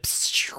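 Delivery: soft hissed "psst" of a bike speeding off; whoosh sound effect. Retold or imitated. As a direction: imitate the bike zipping away with a quick hiss. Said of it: "Nice sound effect".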